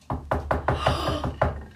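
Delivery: big storybook gasp; sharp intake of breath at a surprise; startled gasp. Acted. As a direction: gasp for the children in mock alarm.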